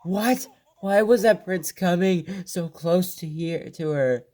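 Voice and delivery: high, alarmed what; a wolf spotting the prince too close; startled wolf. Acted. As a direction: react in sudden alarm.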